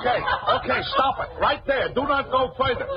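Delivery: high, laughing begging her to stop; laughing plea. Acted. Pleading for the dance to stop.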